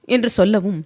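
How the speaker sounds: plain reading voice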